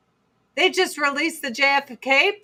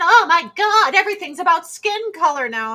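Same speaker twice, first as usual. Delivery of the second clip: mock outraged public; 6 semitones higher, 5 dB louder than usual.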